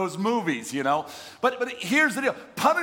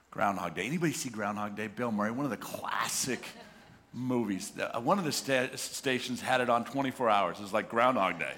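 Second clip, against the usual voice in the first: ordinary talk, nothing marked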